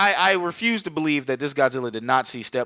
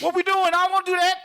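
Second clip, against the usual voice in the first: squeaky, much higher mock ape; high mock King Kong voice; faster than usual. S0 voicing King Kong dangling in the air, squeaky high whine. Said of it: "he's like".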